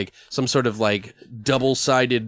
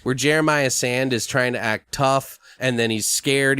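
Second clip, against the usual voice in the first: normal talking voice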